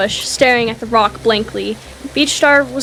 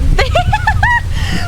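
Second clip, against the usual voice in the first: real laugh. Real, not acted. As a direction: burst of high laughter. Laughing at the ants joke.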